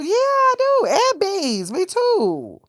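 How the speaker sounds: high sing-song yes; gushing agreement. Real, not acted. Answering chat about loving peas.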